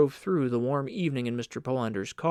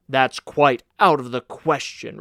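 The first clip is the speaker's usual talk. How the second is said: louder, curt refusal; firm retort; 9 dB louder than usual. Acted. Uncle Jacob refuses Polander's plea with a firm, louder retort. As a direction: shut the request down flatly. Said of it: "retorted Uncle Jacob".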